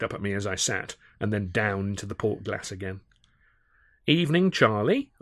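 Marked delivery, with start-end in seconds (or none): 4.1-5.1 high, cheery greeting with a lifted ending; bright greeting. A narrator's reading. Narrator greets Charlie at his table, brightly. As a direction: greet him brightly, pitched up. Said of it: "I began brightly".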